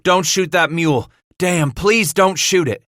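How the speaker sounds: loud, high desperate plea; urgent pleading. A narrator's reading. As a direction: beg him urgently to hold fire. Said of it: "Bass pleaded".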